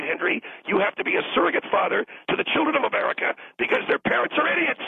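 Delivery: ordinary talk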